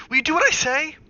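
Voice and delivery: high, very loud roar that cracks; father roars at wife to obey. A narrator's reading. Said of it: "he roared".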